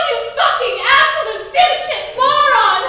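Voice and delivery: furious shout. Acted. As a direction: scream the insult, laughing. Rhonda insults Cameron.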